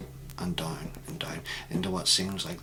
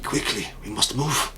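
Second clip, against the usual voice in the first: urgent, raised Eastern European voice; Eastern European accent; 4 semitones higher, 7 dB louder than usual. Acted. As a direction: press them on in a louder Eastern European accent. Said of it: "an Eastern European accent".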